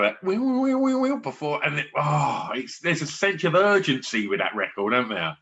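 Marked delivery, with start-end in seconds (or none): 0.6-1.6 high sung bass riff; bass line imitation. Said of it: "that little bass line".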